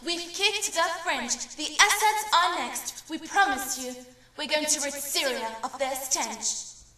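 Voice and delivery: furious, loud, high defiant yell; raging yell. Acted. Poet voicing her lover yelling at Al Asad, raging yell. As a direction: yell it in rage at the regime. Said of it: "yells with rage".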